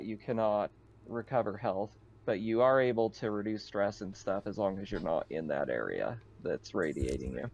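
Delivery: normal talking voice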